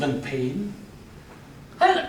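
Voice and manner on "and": high, quick, laughing proposal; a hopeful soldier, higher and eager; cheery wounded soldier. Acted. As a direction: pitch the plan brightly to his mate. McPhee's cheery plan voiced.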